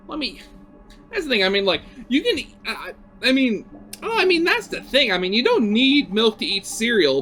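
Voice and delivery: ordinary talk, nothing marked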